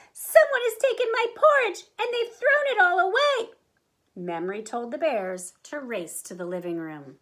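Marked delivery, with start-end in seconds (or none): 0.1-3.5 put-on tiny, very high baby bear cry; wee little voice. A narrator's reading. Baby Bear finds the mess, wee little wail. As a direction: wail it loud and squeaky-high. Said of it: "cried in his wee little voice".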